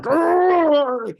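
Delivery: loud, high frustrated argh; frustrated growl. Retold or imitated. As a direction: let out an exasperated argh. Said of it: "I'm like".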